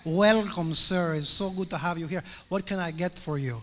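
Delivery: mock posh American waiter accent; waiter's English accent. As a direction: greet like a fancy steakhouse waiter. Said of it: "te habla con un acento".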